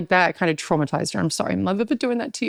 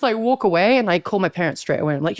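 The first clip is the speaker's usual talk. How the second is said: normal talking voice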